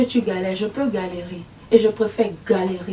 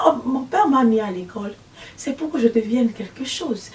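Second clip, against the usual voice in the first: ordinary talk, nothing marked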